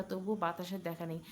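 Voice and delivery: usual speaking voice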